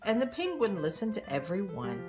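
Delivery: plain reading voice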